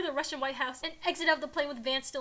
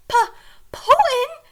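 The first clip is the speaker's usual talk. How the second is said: high, loud stammered question; shocked; 12 semitones higher, 13 dB louder, much slower than usual. A narrator's reading. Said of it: "Joe says, shocked".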